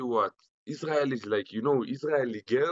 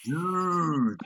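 American accent; 4 semitones higher than usual. Shown as a demonstration. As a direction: ham up a party-bro American accent. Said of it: "give me your best American accent".

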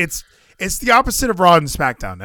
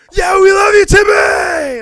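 much higher and louder holler; drunk fan yelling; 10 dB louder, slower than usual. Retold or imitated. Mimicking a fan shouting at Tim Storm, loud holler. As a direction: yell like a fan who wants to be heard. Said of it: "some guy's like".

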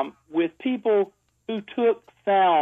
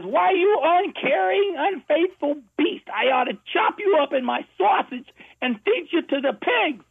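granny screaming; 11 semitones higher than usual. Acted. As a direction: drag out the insults like a furious granny. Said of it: "screaming at him".

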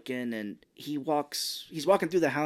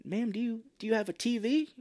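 tentative question in a mock high voice; Mark Wahlberg; 8 semitones higher than usual. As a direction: lift it high and keep it polite. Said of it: "Mark Wahlberg's like".

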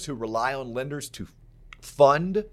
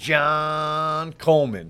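grand entrance announcement; 5 dB louder, much slower than usual. Acted. Introducing co-host with flourish.

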